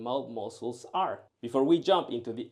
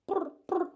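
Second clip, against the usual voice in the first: rolled purr, high and trilled; purring cat sound. Shown as a demonstration. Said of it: "like a purring cat".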